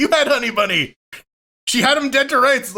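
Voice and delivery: high-pitched comic indignation; exasperated protest. Retold or imitated. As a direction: argue the obvious with mock outrage. Protesting a missed film quote.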